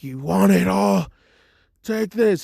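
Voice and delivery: high, loud battle cry; furious taunt. Retold or imitated. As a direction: hurl it at the opponent. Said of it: "And Todoroki's just like".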